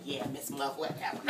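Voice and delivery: a lazy, dismissive mumble like an unimpressed pupil; bored kids. Retold or imitated. Teacher mimics her pupils answering her, mock bored mumble. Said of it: "They're like".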